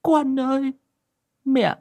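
very high, tearful apology; mother apologizing to husband and son, choked up; choked with tears. Acted. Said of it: "nghẹn ngào".

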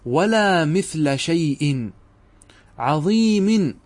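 very slow, each word laid out, عظيم lifted; slow demonstration reading. Shown as a demonstration. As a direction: read ولا مثل شيء عظيم slowly, word by word. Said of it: "وكأنك قلت هكذا".

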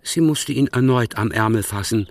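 normal talking voice

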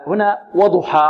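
ordinary talk

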